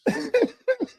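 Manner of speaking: high squealing laugh; high cackle. Real, not acted. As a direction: crack up laughing. Laughing at clip.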